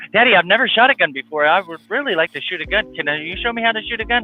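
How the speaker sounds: put-on high, eager child voice, racing at the end; excited little girl. Dad imitating his excited daughter begging to learn to shoot, high girlish voice. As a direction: gush like a thrilled little girl who can't stop talking. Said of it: "she's like".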